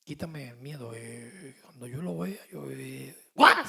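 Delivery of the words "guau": startled yelp. Pretend. Acts being startled by a mouse.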